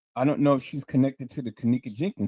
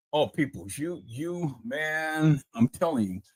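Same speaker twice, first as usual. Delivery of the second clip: normal talking voice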